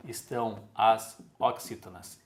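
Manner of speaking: normal talking voice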